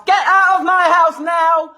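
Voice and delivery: yelling at the police to leave; shouting. Real, not acted. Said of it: "repeats, then yells".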